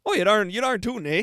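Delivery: put-on high, loud hammy Canadian accent. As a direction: ham up a folksy Canadian. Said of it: "waiting for the Canadian accent".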